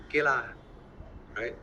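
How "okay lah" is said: Malaysian English with lah at the end; Malaysian accent. Retold or imitated. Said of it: "Malaysian, we say".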